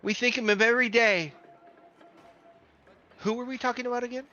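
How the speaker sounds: put-on old clerk thanking the players, high and sweet; old woman's voice. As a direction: coo it like a forgetful grandmother. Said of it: "She's like".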